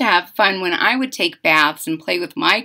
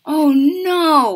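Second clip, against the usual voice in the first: oh no; 6 semitones higher, 5 dB louder than usual. Acted. Reacting to child lost.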